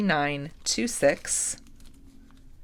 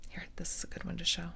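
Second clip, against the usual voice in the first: whisper; 14 dB quieter, faster than usual. Real, not acted. Showing beads close up.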